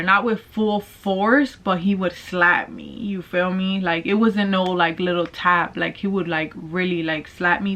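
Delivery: ordinary talk, nothing marked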